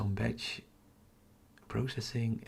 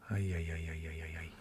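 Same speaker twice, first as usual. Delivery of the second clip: low dismayed muttering, fading softer; 5 semitones lower, 4 dB quieter, faster than usual. Real, not acted. Streamer to himself after equipment trouble, dismayed muttering.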